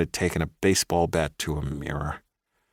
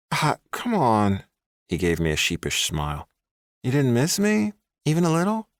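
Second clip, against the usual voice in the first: pleading ex; 8 semitones higher than usual. A narrator's reading. Ex boyfriend coaxing the woman he tracked down. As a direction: coax her gently. Ex wheedles.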